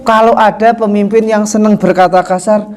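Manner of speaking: ordinary talk, nothing marked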